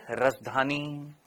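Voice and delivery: lingering, measured delivery; Prime Minister-like tone. Shown as a demonstration. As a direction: stretch the syllables like an orator. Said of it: "ऐसे वाली है ना".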